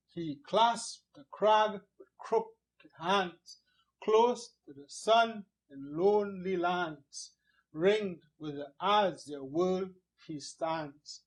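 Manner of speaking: metrical beat, weight on "clasped", "crag", "sun", "lands", "stands"; rhythmic verse reading. Shown as a demonstration. Teacher reciting this first verse, rhythmic stress on "clasped", "sun", "stands". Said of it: "it helps with the rhythm".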